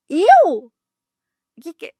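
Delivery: high loud disbelieving me; a sudden high, loud incredulous yelp. Retold or imitated. Reliving the moment she's blamed by witches, loud and incredulous.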